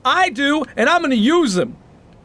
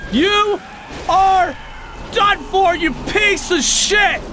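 high, word-by-word battle cry; triumphant threat; 10 semitones higher, slower than usual. Real, not acted. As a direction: punch out each word at the beaten boss. Finishing off the boss.

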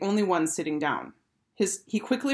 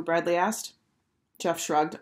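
ordinary delivery, nothing marked

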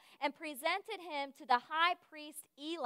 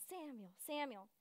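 very soft, hushed name call; 2 semitones lower, 16 dB quieter than usual. Retold or imitated. The Lord calling Samuel once more, hushed. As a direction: call the name gently, far softer.

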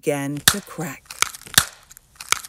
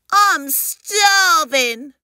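high, loud, drawn-out complaint; starving hatchling; 10 semitones higher, 6 dB louder, much slower than usual. Acted. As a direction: wail hungrily in a slow, high creature voice. Hungry baby complains.

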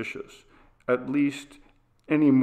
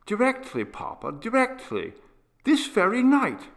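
put-on a boy's higher voice, urgent and eager; earnest young boy; 6 semitones higher, 3 dB louder than usual. Boy urging his father to do something, in an eager higher boyish voice. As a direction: voice a small boy begging his father to act.

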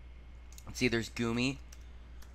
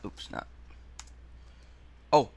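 ordinary talk

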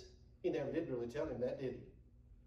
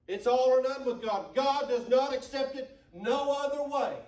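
loud, higher, rising sermon cadence; full-force preaching; 6 semitones higher, 6 dB louder than usual. Acted. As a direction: hammer the point home to the church.

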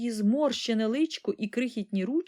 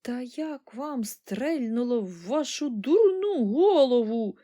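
high, drawn-out scolding; raised, outraged old-woman voice; furious granny; 4 semitones higher, slower than usual. A narrator's reading. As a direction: berate him indignantly, stretching the words. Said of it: "люто гаркнула бабуня".

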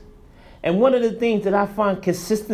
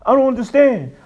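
ordinary talk, nothing marked

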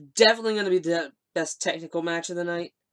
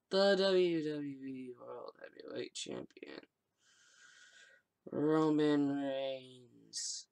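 deflated announcer voice; 3 semitones lower, 8 dB quieter, much slower than usual. Pretend. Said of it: "in my announcer voice".

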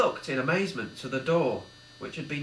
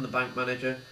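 usual speaking voice

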